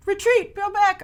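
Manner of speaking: high, urgent call to fall back; mock battle order. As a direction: shout the retreat, half-joking. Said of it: "so they're like".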